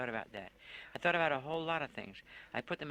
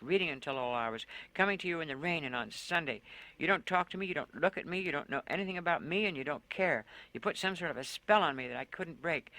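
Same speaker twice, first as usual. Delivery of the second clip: raving on; 4 semitones higher, 4 dB louder than usual. Acted. Woman keeps raving at Lone about what he took, angry and loud. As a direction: keep pouring out the grievances. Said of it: "I raved at him".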